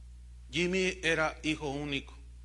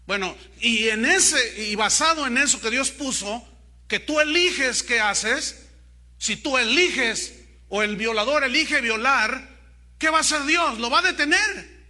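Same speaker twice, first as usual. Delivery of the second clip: fervent preaching; 5 semitones higher, 9 dB louder than usual. Acted. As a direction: preach it out, building to the questions. Preaching at full force. (predicación encendida, en voz alta y cada vez más aguda)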